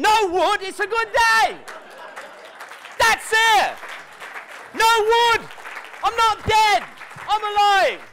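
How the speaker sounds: loud joyous I'm alive; triumphant shout. Shown as a demonstration. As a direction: shout it like you survived the night. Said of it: "joyful wake-up demo".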